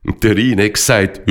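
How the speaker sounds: plain reading voice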